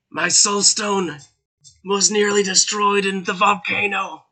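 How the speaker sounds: high, rasping voice from beyond the grave; commanding spirit voice rising to a high shout; dying Dark Lord's whisper. Acted. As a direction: speak as a ghostly lord pressing his servant.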